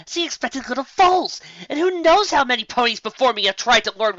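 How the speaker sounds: high, loud, eager rant; high-pitched eager scheming; gleeful scheming. Acted. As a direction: talk yourself into it breathlessly. Excited monologue reasoning.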